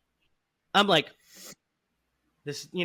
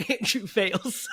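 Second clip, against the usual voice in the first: shrieking laugh through the words; laughing punchline; 11 semitones higher, 7 dB louder, much slower than usual. Real, not acted. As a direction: crack up as you deliver it.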